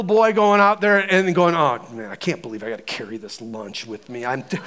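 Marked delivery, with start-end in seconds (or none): 1.5-4.6 low, soft, reluctant mutter; sulky grumbling. Acted. Little boy sent out carrying Mom's lunch, sulky grumbling. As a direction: mumble it down low like a put-upon kid.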